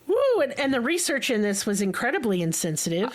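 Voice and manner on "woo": sharp high relieved whoo; loud whoo exhale. Real, not acted. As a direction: blow off tension with a whoo. Exhaling after heavy topic.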